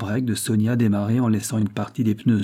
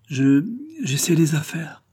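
higher, slow, halting excuse; flustered stammer; 7 semitones higher, slower than usual. A narrator's reading. Magali caught in Sonia's room, stammering. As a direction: stumble on the first word, embarrassed. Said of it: "balbutia-t-elle".

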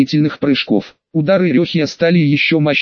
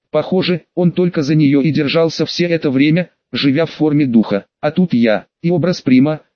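plain reading voice

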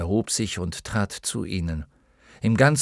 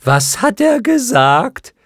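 high, slow, loud creature voice; a little clown moth shouting its question; creature calling down; 12 semitones higher, 9 dB louder, slower than usual. A narrator's reading. Creature at the very top asks about Bastian, calling down. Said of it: "Eines von ganz oben fragte".

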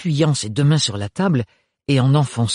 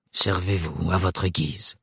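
cold, even, a touch lower; voice without warmth; 6 semitones lower, 5 dB quieter, slower than usual. A narrator's reading. Thorne offering his castles, cold and flat. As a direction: speak coolly, slowly, giving nothing. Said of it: "d'une voix dénuée de chaleur".

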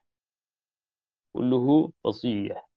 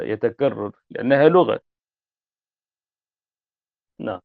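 usual speaking voice

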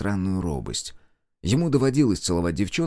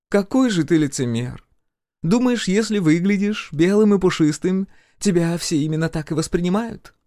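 озлобленная девушка с ирокезом; 10 semitones higher, 4 dB louder than usual. Acted. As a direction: tear into a hypocrite, pitched high. (высокий голос обличающей девушки)

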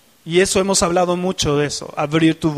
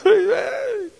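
overwhelmed cry; 12 semitones higher than usual. Retold or imitated. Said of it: "la presencia".

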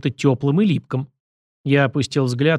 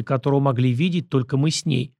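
ordinary delivery

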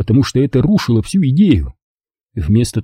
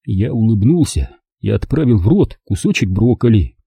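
plain reading voice

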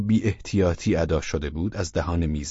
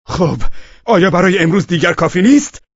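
higher and much louder, furious; savage shout; 11 semitones higher, 10 dB louder than usual. Acted. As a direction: bark him into silence. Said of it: "سبعانه فریاد کشید". (فریاد خشمگین و وحشیانه)

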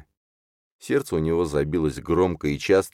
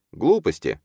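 lightly scoffing, a little higher; dismissive snort; 4 semitones higher than usual. A narrator's reading. Юрген dismisses talk of others' envy, scoffing. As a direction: brush it off with a mild snort. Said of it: "фыркнул Юрген".

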